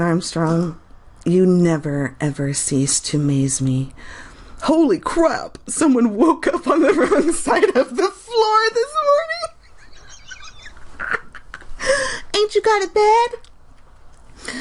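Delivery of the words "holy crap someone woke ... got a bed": high, giggling through the words; cracking up mid-sentence; laughing while reading a comment; laughing exclamation. Real, not acted.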